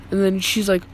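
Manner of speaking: ordinary delivery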